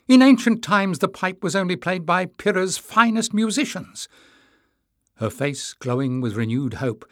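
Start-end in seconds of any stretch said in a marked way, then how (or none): 0.1-4.1 high, loud argument; impassioned cry. A narrator's reading. Tyrrell arguing against lending the pipe, impassioned cry. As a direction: press the point in a raised voice. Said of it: "Tyrrell cried".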